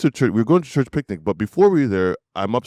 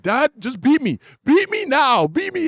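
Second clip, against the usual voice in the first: high-pitched mock-panicked kid pleading; retold yelling. Kid yelling downstairs to dad after the fire, mock yell. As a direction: imitate a child yelling urgently, voice climbing. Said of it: "I'm yelling to my dad".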